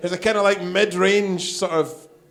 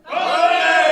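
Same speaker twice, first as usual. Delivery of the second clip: crowd countdown; 7 semitones higher, slower than usual. Real, not acted. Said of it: "I need to get everybody to say".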